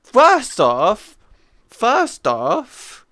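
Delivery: high, loud repeated exclamation; real disbelief, loud and high; incredulous. Real, not acted. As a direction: stammer it out, still stunned. Shocked follow-up.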